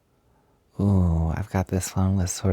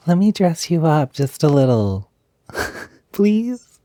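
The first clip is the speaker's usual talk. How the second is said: cute begging, higher and louder, giggle into a high please; pleading; 8 semitones higher, 5 dB louder than usual. Acted. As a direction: coax them playfully into saying yes. Begging listener to dress up.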